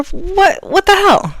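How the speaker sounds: high, loud exasperated snap at her daughter; angry outburst. Retold or imitated. Mother catching daughter in the custard, angry. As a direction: blow up at a kid caught sneaking dessert. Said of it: "I got mad".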